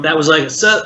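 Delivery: normal talking voice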